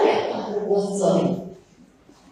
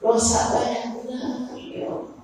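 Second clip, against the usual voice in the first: ordinary talk, nothing marked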